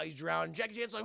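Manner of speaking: usual speaking voice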